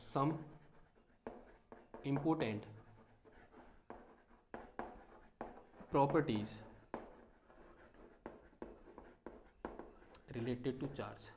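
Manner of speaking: dictating while writing. Real, not acted. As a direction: murmur each piece as you write it on the board. Saying heading while writing it out. (धीमी आवाज़ में लिखते हुए रुक-रुक कर बोलना)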